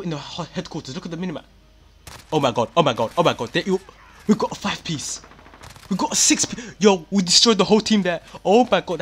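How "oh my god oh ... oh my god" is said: loud, high, thrilled shouting at the screen; excited yelling. Real, not acted. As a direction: yell it out, overjoyed at the kill streak. Teammates wiped, huge killstreak.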